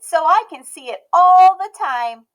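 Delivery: put-on high, bright little girl voice, loud and proud; a stubborn little girl declaring herself; Emmy's child voice. A narrator's reading. Emmy speaking.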